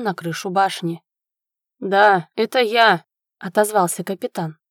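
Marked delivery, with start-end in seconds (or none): 1.8-3.0 loud answer to a distant voice; calling back. A narrator's reading. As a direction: call back up the stairs. Captain answering a distant call.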